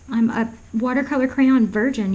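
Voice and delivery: usual speaking voice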